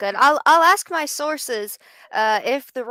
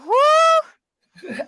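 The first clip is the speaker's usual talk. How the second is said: Ric Flair whoo; 13 semitones higher than usual. Retold or imitated. Host imitating a wrestler's celebration whoo. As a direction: let out a big showman whoo. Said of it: "I will go".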